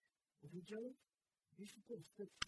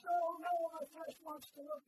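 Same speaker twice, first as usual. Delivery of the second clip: tempted flesh; 12 semitones higher, 15 dB louder than usual. Acted. Tempted flesh voiced in sermon.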